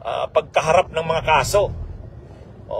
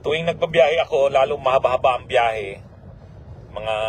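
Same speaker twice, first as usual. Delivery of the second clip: usual speaking voice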